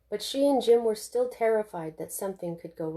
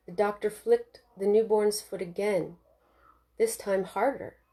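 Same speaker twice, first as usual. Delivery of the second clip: ordinary delivery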